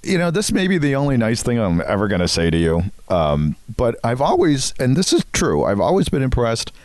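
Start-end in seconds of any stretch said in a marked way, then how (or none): none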